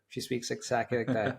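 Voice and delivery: normal talking voice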